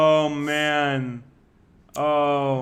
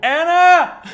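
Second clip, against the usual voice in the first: calling out; 13 dB louder than usual. Real, not acted. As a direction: call the name loudly and high. Calling out to a friend.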